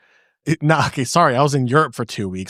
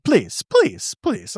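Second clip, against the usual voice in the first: imitating a German airport lady's accent, higher; light German accent on the repeated pleas; 7 semitones higher, much slower than usual. German accent, a gate agent insisting to a traveler. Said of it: "Great accent".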